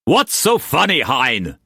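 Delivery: high, irritated demand; impatient. A narrator's reading. Mauss questioning the guffawing Brock, impatient. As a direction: snap the question, starting high. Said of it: "Mouse asked impatiently".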